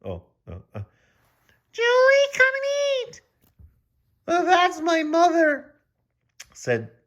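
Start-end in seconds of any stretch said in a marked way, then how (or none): 1.7-3.2 calling from down the street, very high; mock distant call; high far-off call. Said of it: "someone yelled". 4.3-5.7 put-on high squeaky girl voice, slow; a high squeak meant for Julie; Julie's voice done wrong. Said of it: "Oh, I'm messing up".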